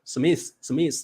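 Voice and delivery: 什么意思 run together so it sounds like "Smith"; 什么意思 slurred to Smith. Shown as a demonstration. Teaching the Taiwanese slur to her co-host, sounding out Smith. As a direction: blur 什么意思 into one word, Smith. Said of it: "我们会这样念的哦".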